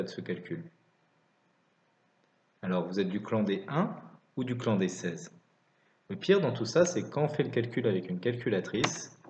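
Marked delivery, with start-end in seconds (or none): none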